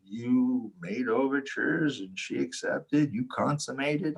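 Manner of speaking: slow, drawn-out pronouncement in another man's voice; interpreter explaining. Retold or imitated. Interpreter tells storyteller of his wedding night, slow imitation. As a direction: imitate the interpreter laying out the facts slowly. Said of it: "he was just like".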